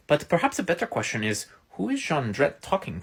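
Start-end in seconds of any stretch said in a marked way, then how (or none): none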